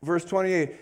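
normal talking voice

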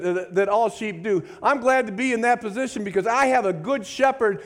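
high, loud preaching climax; fervent preaching. Acted. Pastor urging the church to clap, preaching at full force. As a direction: pour it out to the congregation, lifting them up.

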